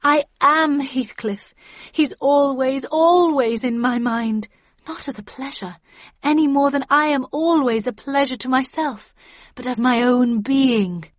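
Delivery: swooning melodrama, stretching key words; impassioned declaration. A narrator's reading. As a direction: pour out your devotion to the whole group. Catherine declares love over barracking.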